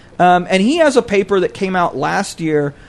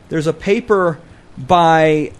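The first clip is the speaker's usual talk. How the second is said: normal talking voice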